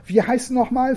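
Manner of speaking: put-on high, curious child; the Dutch girl asking her name; little girl's voice. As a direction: ask like an eager small girl. Other girl asks name.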